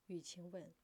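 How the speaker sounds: ordinary delivery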